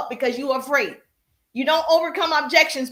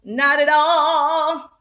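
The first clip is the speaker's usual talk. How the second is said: loud, long-drawn singsong refusal; emphatic refusal; 3 semitones higher, 6 dB louder, much slower than usual. Real, not acted. Host banning a rude person, drawn-out emphatic refusal. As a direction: stretch it right out for emphasis.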